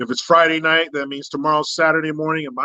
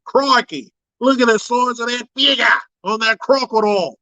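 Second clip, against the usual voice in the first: a higher, hammed-up Australian accent, Crocodile Dundee style; 5 semitones higher than usual. Streamer imitating an Australian croc hunter for chat, mock Australian accent. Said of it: "he had an Australian accent".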